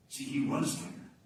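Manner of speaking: ordinary delivery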